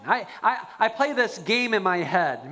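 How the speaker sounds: ordinary talk, nothing marked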